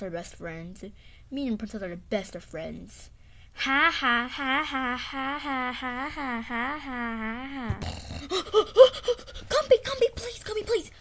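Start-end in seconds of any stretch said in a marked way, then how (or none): 3.5-7.8 loud ha ha fading down; mocking ha ha laugh. Mean gloating laugh at Pokey. 8.3-10.9 high, loud, desperate begging; panicked nightmare plea. Acted. Waking from a nightmare.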